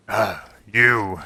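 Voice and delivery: a wary dwarf withholding judgment; gruff dwarf. Acted. GM voices the dwarf NPC.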